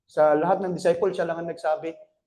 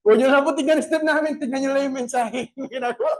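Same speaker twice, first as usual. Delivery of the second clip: fast, higher, giggling plea; laughing; 7 semitones higher, faster than usual. Real, not acted. Dancer pleading with the congregation while laughing. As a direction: laugh through the line.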